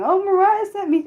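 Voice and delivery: high-pitched mock quote; imitating viewers' excuse. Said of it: "you can't be saying".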